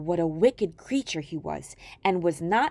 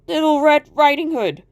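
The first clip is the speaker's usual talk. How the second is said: high, loud put-on girl voice; the wolf pretending at the door; wolf faking a girl; 5 semitones higher, 8 dB louder than usual.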